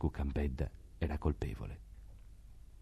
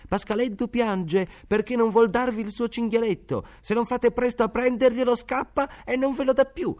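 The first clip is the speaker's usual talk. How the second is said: much higher, louder mocking urchin's yell; a cheeky boy calling out at the door; shouting street boy; 7 dB louder than usual. A narrator's reading. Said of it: "di gridare alla bella serva".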